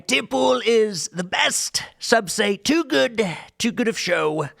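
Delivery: exaggerated rally impersonation, high and drawn out; slow, hammed-up politician boasting to a crowd; Donald Trump. Shown as a demonstration. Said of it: "I'm allowed to imitate Donald Trump saying".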